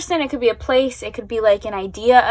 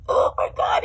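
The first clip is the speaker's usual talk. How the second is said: emotional; 7 semitones higher than usual. Real, not acted. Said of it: "I can't form words".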